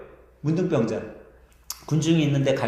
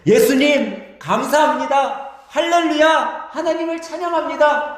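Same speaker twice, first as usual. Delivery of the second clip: crowd crying out; 9 semitones higher, 4 dB louder than usual. Acted. Crowd hailing Jesus as he passes, high praising shouts. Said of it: "사람들이 뭐라고 외쳐요?".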